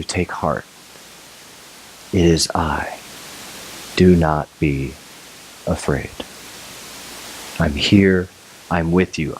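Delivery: soft, low, slow comforting voice; gentle reassurance. Retold or imitated. Jesus speaking to a frightened listener, soft and tender. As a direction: speak peace to someone afraid. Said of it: "he says to you".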